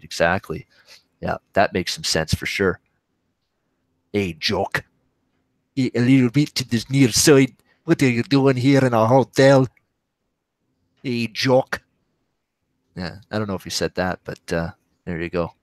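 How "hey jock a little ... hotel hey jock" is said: a put-on Scottish accent, a bit louder, calling out. Greeting a Scottish guy in a hotel, Scottish accent. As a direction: hail your mate Jock in a broad Scottish accent. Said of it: "I can do the old Scottish accent".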